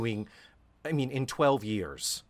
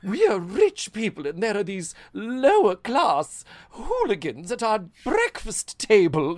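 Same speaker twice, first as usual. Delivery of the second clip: hammed-up upper-class voice, higher and louder; posh snob; 9 semitones higher, 6 dB louder than usual. Host mocking the rich family's class snobbery. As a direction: sneer it like snooty rich folk. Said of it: "that, that whole".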